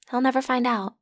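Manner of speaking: ordinary talk, nothing marked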